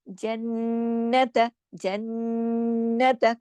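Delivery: जन्नत with the नून hummed long in the nose; very slow nasal recitation of जन्नत; held nasal ghunna. Shown as a demonstration. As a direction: stretch the नन of जन्नत through the nose. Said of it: "काफी देर आवाज को हमने नाक में छुपाया".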